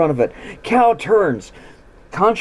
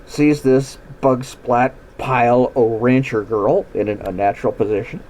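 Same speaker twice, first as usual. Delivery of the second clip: ordinary delivery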